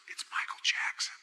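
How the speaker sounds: whispered like secret news; retold hushed whisper. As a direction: whisper it, imitating the director.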